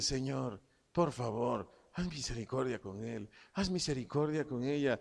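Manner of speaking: soft, humble plea to God; kneeling prayer. Acted. Meek man kneels praying for his offender, softly pleading. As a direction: plead quietly for mercy on someone else. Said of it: "dobla sus rodillas".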